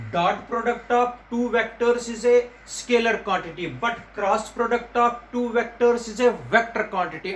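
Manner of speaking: very slow, word by word; slow dictation. Shown as a demonstration. Teacher making students memorise the term, very slowly. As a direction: spell it out slowly for the class. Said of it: "ये याद रखना".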